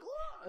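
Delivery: very high squeaky yell; mock battle yell. Brother jumping to kick, squealed yell. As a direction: squeal as you jump. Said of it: "He was like".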